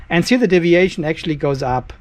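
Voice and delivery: ordinary talk, nothing marked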